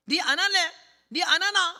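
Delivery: high, loud dialect heckle; demanding old man. Retold or imitated. Old man interrupting the preacher mid-sermon, loud impatient demand. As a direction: badger loudly in regional dialect. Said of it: "이 할아버지 하나가 이래요".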